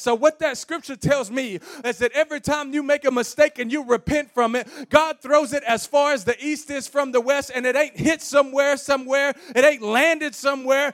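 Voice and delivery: loud, building pulpit proclamation; full-force preaching. Real, not acted. Preacher encouraging somebody who made a mistake, full-force preaching. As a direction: preach it out with rising force and volume.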